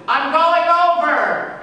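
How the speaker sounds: high, slow, loud yell. Acted. Jonah yelling to the captain over the storm. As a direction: shout it across the deck.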